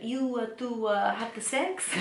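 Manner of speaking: lightly faked Italian broken English; Italian accent. Host voicing Paolo asking Ross, mock Italian accent. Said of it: "he tries to speak with Ross".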